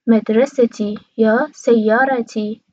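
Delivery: مدرستی and سیارتی as madrasati, sayyarati; Qatari pronunciation. Shown as a demonstration.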